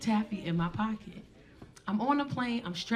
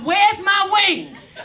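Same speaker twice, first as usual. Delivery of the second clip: panicked yell; 8 semitones higher, 8 dB louder than usual. Retold or imitated. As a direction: bellow it like you've lost it. Panicked screaming on plane.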